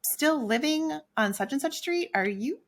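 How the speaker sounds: imitating nosy people asking about her address; nosy acquaintances. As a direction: lightly play the busybody asking questions. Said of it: "they were like".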